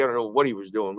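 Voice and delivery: ordinary delivery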